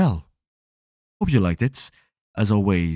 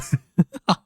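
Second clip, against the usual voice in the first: laugh; 9 semitones higher than usual. Real, not acted. Laughing at own deep voice.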